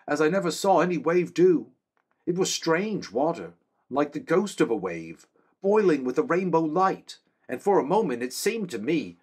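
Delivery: high, earnest soldier's voice; a loyal guard reporting to his king; young man-at-arms. Acted. Same man, loud and high.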